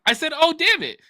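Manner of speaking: put-on child voice quote. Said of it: "He was like".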